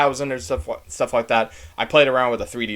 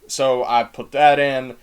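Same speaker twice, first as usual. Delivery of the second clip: ordinary talk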